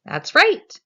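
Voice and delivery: big, loud, high praise to the class; excited teacher praise. Shown as a demonstration. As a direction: cheer the child on. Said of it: "You could say".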